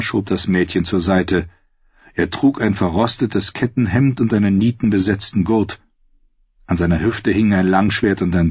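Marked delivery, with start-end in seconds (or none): none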